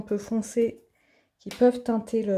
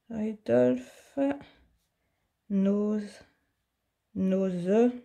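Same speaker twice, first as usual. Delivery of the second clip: French-accented English; much slower than usual. Real, not acted. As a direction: test each English word again under your breath with a French accent. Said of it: "j'ai quelques souvenirs de mon anglais".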